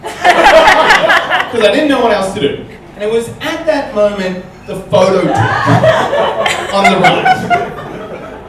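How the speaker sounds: high, loud, slow words broken by laughter; can't get through it for laughing; laughing while telling. Real, not acted. Telling the audience about the photo, laughing hard. Laughing at his own story.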